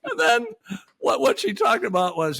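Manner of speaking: ordinary talk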